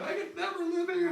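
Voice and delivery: mock-despairing high whine; wife's tearful complaint. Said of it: "she's crying, going".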